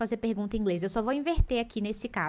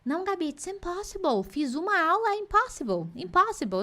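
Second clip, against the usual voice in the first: higher, mock whining student; higher mock-whiny student giving up; 7 semitones higher than usual. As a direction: whine like a defeated student. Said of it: "a galera fala assim".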